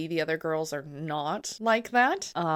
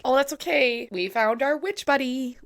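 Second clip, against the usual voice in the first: higher, chirpy mock-cheerful tone; mock cheerful girl; 8 semitones higher than usual. Mocking teen girls who cheer after a man is hit. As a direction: play the girls as cheerfully unbothered. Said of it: "psychotic to laugh".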